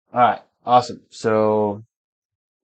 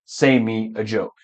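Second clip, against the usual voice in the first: softer, lower wrong version; wrong example; 4 semitones lower, 6 dB quieter than usual. Shown as a demonstration. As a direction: drop the voice for the mistake. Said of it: "and not".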